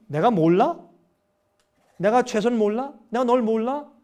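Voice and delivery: loud, exasperated father voice; indignant fatherly retort; stern rebuke. Acted. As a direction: scold the complaining child. God rebuking complaining child.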